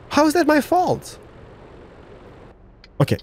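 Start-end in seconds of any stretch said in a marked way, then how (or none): 0.1-1.2 indignant disbelief. Real, not acted. Blamed for a crash in game.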